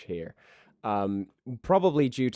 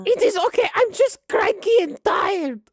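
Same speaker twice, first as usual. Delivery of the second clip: very high, loud granny complaining; high-pitched cantankerous grandma voice; cranky old grandma; 7 dB louder than usual. Acted. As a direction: lay down the law as a tired, tetchy old woman. Grandma says she is cranky.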